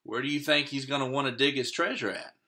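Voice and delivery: ordinary delivery, nothing marked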